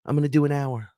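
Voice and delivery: high, repeated promise; lightly mock his own earlier vow; mock insistence. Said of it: "I said".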